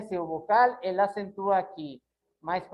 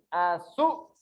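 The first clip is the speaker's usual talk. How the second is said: "Açu-" with the force on the second syllable; stress on ÇU; 2 semitones lower than usual. Shown as a demonstration. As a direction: lean on the "çu" in "Açu-". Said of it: "Onde tá mais forte?".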